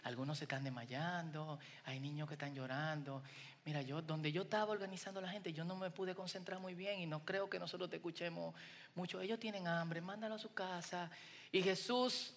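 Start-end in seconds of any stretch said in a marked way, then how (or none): 0.0-11.1 much softer, low, hushed complaint to the master; pleading disciples. Retold or imitated. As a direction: murmur the worry as the disciples. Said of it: "le dicen". (discípulos quejándose en voz baja y grave)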